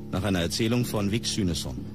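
ordinary talk

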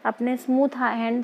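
talking through fingers; hand over mouth. Real, not acted. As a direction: through a face mask. Seen 0: Hands press sheet mask over nose and mouth 1.6–3.1s.

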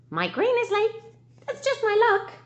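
very high clucking hen. Acted. Teacher reading to her class, as a very high grumbling hen. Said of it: "the hen begin to cluck".

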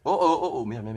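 ordinary talk, nothing marked

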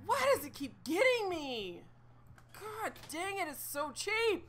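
frustrated. Real, not acted. Frustrated after dying again.

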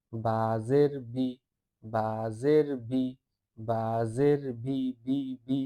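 slow drill recitation. Shown as a demonstration. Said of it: "পারলে উচ্চারণটা কয়েকবার বলবেন".

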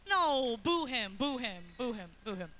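playfully rally a crowd to boo; hammed-up disapproval; mock booing. Jokingly leads crowd booing heckler.

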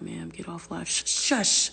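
ordinary talk

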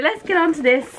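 ordinary delivery

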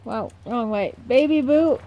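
ordinary delivery

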